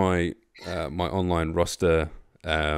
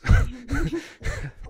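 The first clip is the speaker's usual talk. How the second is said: laughing; 4 dB louder than usual. Real, not acted. Laughing at herself.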